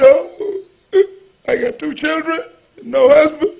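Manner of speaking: tearful, breaking woman's cry; a mother crying in the pastor's office, voice cracking; weeping. Retold or imitated. Woman weeping to the pastor, sobbing. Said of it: "And she began to weep".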